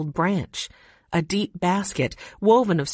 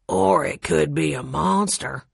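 gravelly; 3 semitones lower than usual. A narrator's reading. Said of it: "said a gravelly voice".

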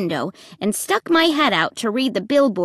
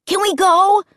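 put-on high, pushy boy; pestering kid's insistent question; demanding; 10 semitones higher, 4 dB louder than usual. A narrator's reading. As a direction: demand it eagerly, pitch way up. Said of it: "Luke demanded".

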